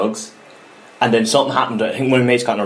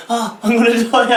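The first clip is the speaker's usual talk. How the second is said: retold mock wail; 11 semitones higher than usual. Retold cramp panic.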